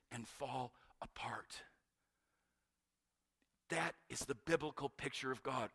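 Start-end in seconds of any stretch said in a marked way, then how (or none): 0.1-1.6 whispered, slow and broken. Real, not acted. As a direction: let it crumble to a whisper. Preacher trails into whisper.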